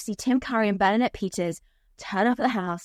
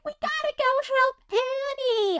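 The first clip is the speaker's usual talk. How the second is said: put-on much higher squeaky child voices in a panic; high, frantic kid voice; panicked orphans; 12 semitones higher than usual. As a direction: play a flustered little orphan. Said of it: "all the orphans are like".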